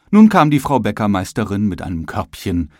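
plain reading voice

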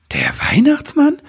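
high, amazed little voice; wide-eyed wonder, pitched high; astonished question. Acted. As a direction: ask in disbelief. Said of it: "fragte Nebelwurz verwundert".

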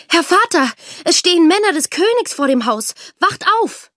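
high, loud alarmed cry; urgent wake-up call. A narrator's reading. Daughter waking her father as soldiers arrive, urgent cry. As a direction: call out urgently to rouse a sleeper.

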